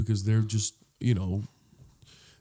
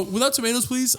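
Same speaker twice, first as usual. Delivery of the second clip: high-pitched mock request; fussy orderer; 10 semitones higher than usual. Customer asking to leave out tomatoes, fussy. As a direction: pitch it up, prissily polite.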